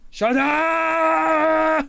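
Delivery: high, loud put-on Arnold yell; Arnold shout. Quoting Arnold's teacher scene as a high, loud yell. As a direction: bark it like Arnold in the teacher film. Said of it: "is that from, like, the one where he was, like, the teacher".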